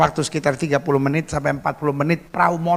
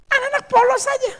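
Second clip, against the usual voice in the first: high-pitched exclamation. Real, not acted. Line pitched far above teaching talk.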